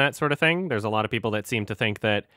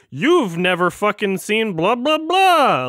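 drawn-out snooty mockery; mocking snob; 7 semitones higher, 5 dB louder, slower than usual. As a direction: sneer it like a film snob. Mocking snobby film fans.

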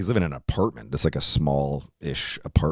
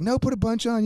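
urging. Retold or imitated. Friends pushing more horseradish.

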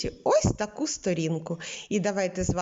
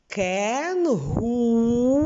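кенгуру very slowly, each у drawn out; кенгуру sounded out slowly; stressed U; 3 semitones higher, 3 dB louder, much slower than usual. Shown as a demonstration. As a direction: stretch both у in кенгуру. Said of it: "вимовляємо так, щоб почути кожен звук У".

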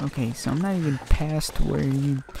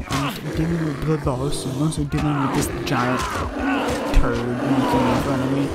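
frantic shout; 4 dB louder than usual. Real, not acted. Fighting bear while bleeding.

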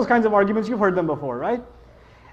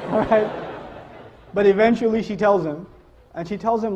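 ordinary delivery

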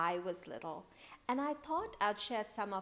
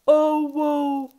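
loud, higher, drawn-out lament; woeful cry; 7 semitones higher, 18 dB louder than usual. A narrator's reading. Boy without his ball, melodramatic woeful cry. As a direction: wail it like a storybook child. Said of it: "cried Paul".

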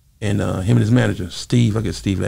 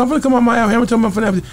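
defensive; 11 semitones higher, much faster than usual. Retold or imitated. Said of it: "I said".

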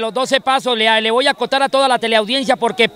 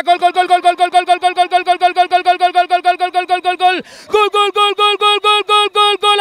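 breakneck goal commentary; 4 semitones higher than usual. Real, not acted. Goal scored in penalty shootout.

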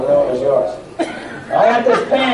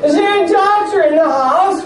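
high alarmed call; 9 semitones higher than usual. Pretend.

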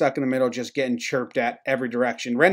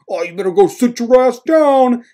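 high, loud jeering yell; mock heckling; 9 semitones higher, 5 dB louder than usual. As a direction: holler a mocking dismissal in a put-on voice. Acted heckle at ejected player.

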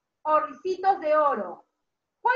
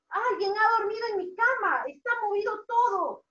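higher, indignant storybook bear; Papa Bear; 5 semitones higher than usual. Acted. Teacher voices papá oso finding his bed slept in, indignant. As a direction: protest in mock outrage. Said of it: "Papá oso dijo".

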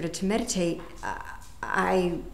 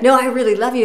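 higher and louder, eager insistence; insistent and excited; 6 semitones higher, 11 dB louder, faster than usual. Retold or imitated. Insisting to the golfer she really loves him, eager. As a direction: press it, you really mean it.

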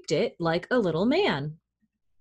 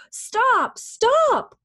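high, urgent storybook shout; crying out; 3 dB louder than usual. A narrator's reading. Old woman chases the runaway gingerbread man, crying out. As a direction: call out sharply, pitch leaping up. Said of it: "cried the old woman".

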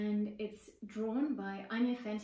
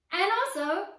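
eager chime-in; 8 semitones higher, 8 dB louder, slower than usual. Acted. Sister chimes in.

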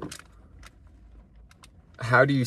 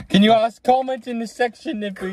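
youtuber voice; 11 semitones higher than usual. Acted. Asking viewers to comment.

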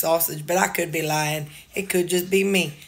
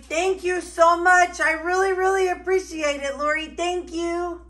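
gushing thanks; 9 semitones higher than usual. Real, not acted. Thanking a donor on camera.

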